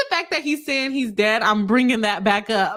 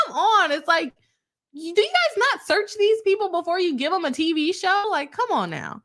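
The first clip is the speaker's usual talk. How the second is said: exasperated; 7 semitones higher than usual. Retold or imitated. Exasperated reaction to TV casting.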